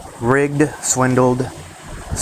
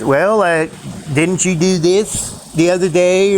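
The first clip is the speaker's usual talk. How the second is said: higher and slower, a put-upon parent stalling; annoyed parent; 6 semitones higher, slower than usual. Retold or imitated. Parent stalling a child asking for something, higher and slow. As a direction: drag it out like a reluctant parent.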